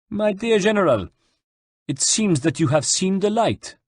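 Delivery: high-pitched, condescending officer; smug French general. A narrator's reading. De Valois addresses his captured enemy, condescending. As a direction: gloat politely over a captive rival.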